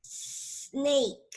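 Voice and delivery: "Snake" opened on a stretched s; long initial S. Shown as a demonstration. Teacher for child to repeat after, long initial S. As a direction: sustain the s, then say "Snake". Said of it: "Ahora después de mí".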